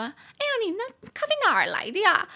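high, fast nosy-lady voice; hammed-up nosy coworker asking about the coffee; busybody coworker. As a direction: mimic the meddling office lady, shrill and prying. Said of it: "就过来跟我讲说".

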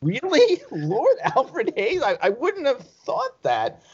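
high, slow, astonished retold reaction; mock disbelief. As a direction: play your own past disbelief, drawn out and high. Retelling his own disbelief at gossip.

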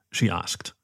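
ordinary delivery, nothing marked

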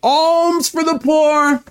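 high, slow, drawn-out beggar's cry; beggar calling out. Acted. Blind beggar calling to crowds on the road, slow high cry. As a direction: call to passing crowds for alms. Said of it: "And he's calling out".

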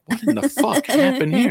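high, laughing disbelief; shocked outburst. Retold or imitated. Finding a scratch on his butt, high laughing disbelief. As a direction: squeak it out in mock shock. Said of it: "I'm like".

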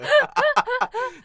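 squealing laugh; shrieking with laughter; high burst of laughter. Real, not acted. Parody cracks her up.

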